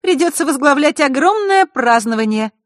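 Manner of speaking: put-on high, loud girlish character voice; high-pitched, emphatic agreement; Zakharra, chiming in. A narrator's reading. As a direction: join in loudly at a high pitch. Поддержала его Захара.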